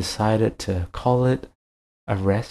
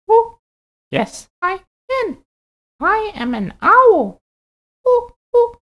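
a friendly owl, high and hooting; 8 dB louder than usual. Acted. Owl figure voiced.

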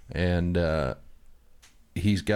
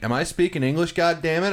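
exasperated yell; 10 semitones higher, 4 dB louder than usual. Retold or imitated. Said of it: "And I'm like".

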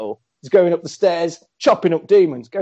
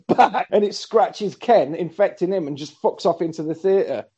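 ordinary delivery

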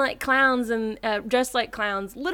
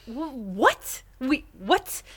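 indignant disbelief; 5 semitones higher than usual. Acted. Acts Ivy's indignant disbelief.

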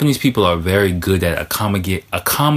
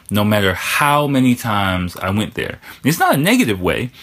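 ordinary talk, nothing marked

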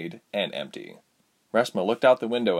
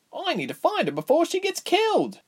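put-on urgent young heroine. Reshma urgent.

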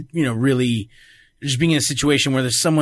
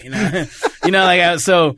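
ordinary talk, nothing marked

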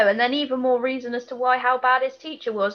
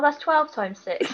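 usual speaking voice

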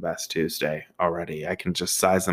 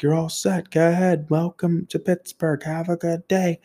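high-pitched holler from a distance; called-out send-off; 10 semitones higher than usual. Retold or imitated. Maintenance man seeing the van off, high calling voice. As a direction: call it out high to a departing driver.

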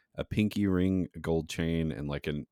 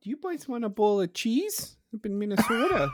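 mock Minnesota accent; 3 dB louder than usual. Mocking Minnesotans.

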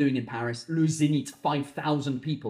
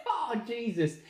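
softer, laughed-out words; laughing; 5 semitones higher, 5 dB quieter, slower than usual. Real, not acted. Comedian cracks up at his own rant, laughing. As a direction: let the words dissolve into laughter.

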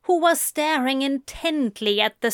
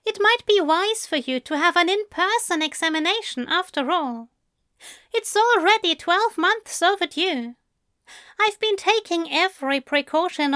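higher, gentle English gentleman; Aziraphale voice; 8 semitones higher than usual. A narrator's reading. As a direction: lift the voice for the fussy angel.

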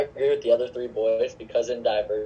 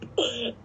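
short laugh; 13 semitones higher, 3 dB quieter than usual. Real, not acted. Laughing at the voice crack.

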